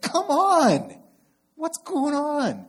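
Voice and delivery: high, loud exasperated cry of come on; agitated outburst. Retold or imitated. Pastor acting out his own frustration at life, high exasperated yell. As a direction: throw up your hands in mock frustration. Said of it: "get more and more agitated".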